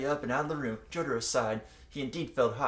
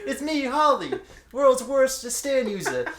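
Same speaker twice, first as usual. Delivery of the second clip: high, loud, slow hammed mom; Holly voice; 12 semitones higher, 9 dB louder than usual. Friend hamming up Jotaro's mom on the phone. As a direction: overact a bubbly cartoon mom. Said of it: "You get to be Holly".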